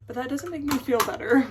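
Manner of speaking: a frustrated outburst; emphatic. Real, not acted. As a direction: press hard on "me" in "make me feel better". Frustrated about missing boyfriend.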